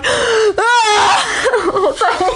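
high startled laugh-cry. Retold or imitated. Caught crying by a fan, a startled laughing cry. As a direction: yelp through tears and laughter. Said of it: "And I'm like".

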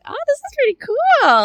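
a giddy high gasp of wonder; delighted and impressed. Retold or imitated. To herself while twirled by a stranger, giddy and amazed. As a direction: squeal it up high and bright, amazed.

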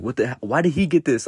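imagined mad sister. Retold or imitated. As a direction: imitate an outraged sibling complaining. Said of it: "I want her to be mad".

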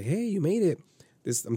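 high, singsong hello; imitated woman's greeting. Imitates woman greeting him at door.

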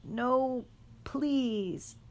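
high, desperate begging; pleading moan. A narrator's reading. Sirius begging the Dementors, a high desperate plea. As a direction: plead with the dark. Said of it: "he moaned".

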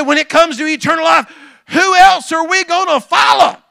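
high, loud shouted question; preaching shout. Acted. Peter's final answer shouted out by the pastor, loud. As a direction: shout it to the back pews.

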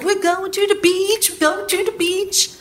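high, sing-song imitation of the husband; cheery husband. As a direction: mimic him bouncing in, clueless and chipper. Husband cheerfully announcing beach trip.

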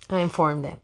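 ordinary delivery

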